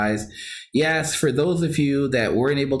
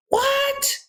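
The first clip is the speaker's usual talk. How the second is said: high, squealing "what?" of disbelief; can't believe the number he just read; shocked disbelief; 10 semitones higher than usual. Real, not acted. Reading out a viewer's comment, stunned disbelief. Reacting to Tim's big coin haul.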